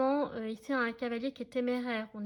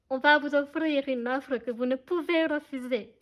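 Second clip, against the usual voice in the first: put-on mauvais accent italien du Parrain; 5 dB louder than usual. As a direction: attempt the Godfather's line in a clumsy Italian accent. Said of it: "désolée d'avance pour l'accent italien". (accent italien raté, un peu surjoué)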